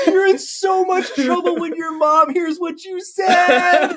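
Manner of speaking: high, slow, teasing dad voice; smug dad who caught his son swearing; gloating dad. Retold or imitated. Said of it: "He's like".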